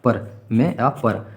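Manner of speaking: मैं and पर spaced out very slowly; slow spelled out. Shown as a demonstration. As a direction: lay out मैं, अ, पर word by word. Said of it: "अधिकरण का मतलब होता है मैं अ पर".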